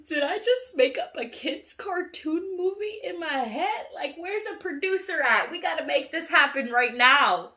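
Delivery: high, loud, urgent pitch; hyped-up excitement. Retold or imitated. Dreamer demanding a producer for her movie idea, loud excitement. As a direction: exclaim like you've struck gold.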